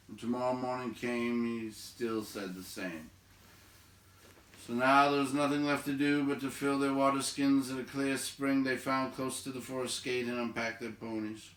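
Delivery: ordinary delivery, nothing marked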